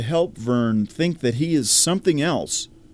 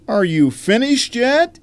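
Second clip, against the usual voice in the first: impatient; 9 semitones higher, 4 dB louder than usual. Acted. Impatient repeated question.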